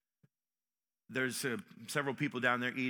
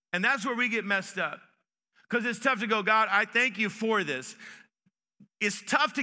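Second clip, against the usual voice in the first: higher, louder sermon delivery; emphatic preaching; 8 semitones higher, 8 dB louder than usual. Real, not acted. Preacher urging a congregation to thank God through hardship, emphatic preaching. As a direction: preach it with raised pitch and force.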